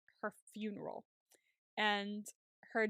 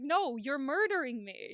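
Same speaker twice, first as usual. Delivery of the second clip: higher, louder, drawn-out retort; mock indignant protest; 4 semitones higher, 6 dB louder, slower than usual. [name] pleading with the nurse prepping surgery, mock protesting. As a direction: imitate a patient protesting slowly and loudly.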